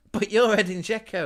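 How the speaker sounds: high, loud, insistent amazement; starstruck disbelief. Retold or imitated. Dazed patient to his idol on a video call, starstruck disbelief.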